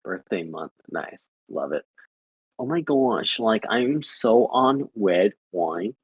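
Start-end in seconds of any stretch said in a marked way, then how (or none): 2.6-6.0 drawn-out, badly faked Aussie accent; clumsy, slow attempt at Australian. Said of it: "No, that was bad".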